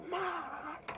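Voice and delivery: "must" squeezed out very high; exaggerated squeal. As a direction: stretch "must" in a high mock strain.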